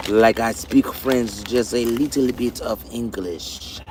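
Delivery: slow, drawn-out imitation French accent; put-on French accent. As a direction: do a rough French accent, slowly. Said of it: "Did you try to make the French accent?".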